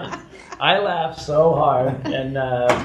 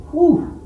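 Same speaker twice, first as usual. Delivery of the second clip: mock relief whew; 3 dB louder than usual. Relief exclamation.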